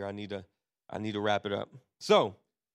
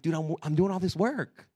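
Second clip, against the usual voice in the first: louder, mildly exasperated retold complaint; mock outrage, lightly acted; furious daughter; 3 semitones higher, 6 dB louder than usual. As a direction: imitate an aggrieved kid protesting. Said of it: "Leah will be so furious".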